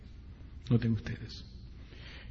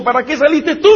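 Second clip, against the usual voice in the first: high, loud pained question; anguished reproach; 10 semitones higher, 6 dB louder than usual. Acted. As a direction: demand it in despair. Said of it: "como diciendo". (reproche angustiado de padre a su hija)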